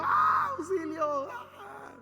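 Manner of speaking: mock terror scream breaking into a cry; terrified screams. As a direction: act out sailors screaming for help in terror. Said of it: "Yo creo que estaban gritando".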